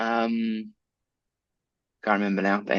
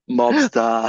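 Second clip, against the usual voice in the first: mobster voice; 12 semitones higher, 4 dB louder than usual. Pretend. Mock mobster farewell.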